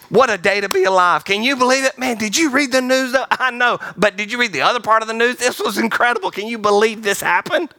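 high, eager, running-on enthusiasm; amazed optimist. Acted. Glass-half-full person marvelling at life, excited and high. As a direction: gush about the news as an optimist. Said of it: "This life is amazing".

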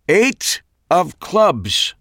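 slow, high theatrical repeat; dramatic echo. A narrator's reading. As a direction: stretch it out for suspense. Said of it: "Max repeated".

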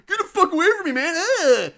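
high, loud mock-angry snap; hammed-up rage ending in a disgusted grunt; mad stranded guy. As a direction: shove them off with an overblown yell. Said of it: "Why would he be so mad".

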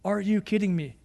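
ordinary delivery

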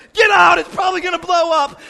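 loud, high alarmed command; urgent shout. Retold or imitated. Driver to passenger fleeing the car, urgent high shouting. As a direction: yell it like the car may explode.

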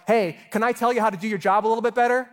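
high, loud, fast cheeky offer to help; mock pushy suggestion. Martha advising Jesus on his job, cheeky and fast. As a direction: toss it off like a know-it-all telling the boss how to work.

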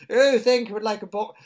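high, loud mock-posh RP; overdone genteel politeness; put-on posh accent. Friend trying the posh accent on the shopkeeper. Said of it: "tried it on with this".

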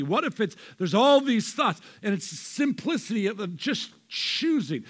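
loud, rising sermon delivery; preaching at full force. Acted. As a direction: drive the point home to the congregation.

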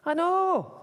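high, loud agreement; exasperated shout. Acted. Joseph answering the innkeeper, shouting in exasperation. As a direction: yell it back high. Joseph snapping back in quarrel.

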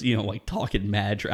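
ordinary talk

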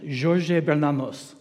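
drawn-out amateur French pronunciation; attempted French accent. Shown as a demonstration. Preacher who doesn't speak French names an author, attempted French accent. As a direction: say the name slowly in a stab at a French accent. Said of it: "I try to do a French accent".

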